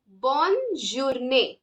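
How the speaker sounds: "bonne journée" slowly, the n of bonne clearly sounded; tongue up on the n in "bonne journée"; drawn-out "bonne journée" with a full n; sounded double N. Shown as a demonstration. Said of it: "The tongue touches the upper part".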